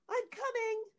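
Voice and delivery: very high-pitched, placating reply; timid answer. A narrator's reading. Wife answers her threatening husband, high meek call. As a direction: call back meekly to stall him.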